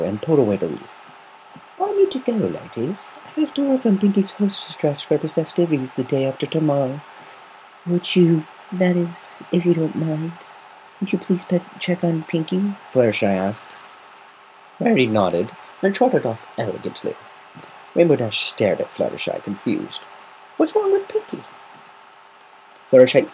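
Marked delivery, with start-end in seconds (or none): 1.8-7.0 higher refined lady; polite exit; lightly voiced Rarity. Rarity excusing herself to the ladies, refined. 7.9-12.7 high, hesitant plea; timid Fluttershy. Acted. Fluttershy timidly asking Rarity for help. 20.6-21.4 high, confused question; puzzled; voiced Rainbow Dash. Rainbow Dash confused, asking Fluttershy.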